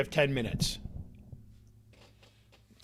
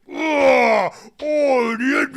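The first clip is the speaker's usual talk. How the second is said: loud, high anguished wail; crying shout; 10 semitones higher, 11 dB louder, much slower than usual. Retold or imitated. The sumo wrestler cries out to Diego, wailing. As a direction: cry out the name. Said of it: "and he's like".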